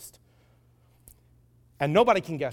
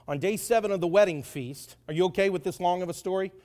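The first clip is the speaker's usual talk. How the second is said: ordinary delivery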